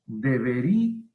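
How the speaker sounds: DEBERÍA with the í stressed, split from the a; DEBERÍA said with a clear hiatus before the final a. Shown as a demonstration. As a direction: break DEBERÍA into de-be-RÍ-a, leaning on RÍ. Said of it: "la vocal débil lleva acento y ahí se rompe".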